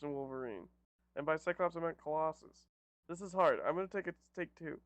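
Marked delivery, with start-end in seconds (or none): none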